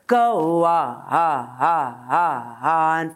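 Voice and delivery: deep sung "on, on, on"; low sung exercise. Shown as a demonstration. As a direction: drop down low on each "on". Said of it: "low voice".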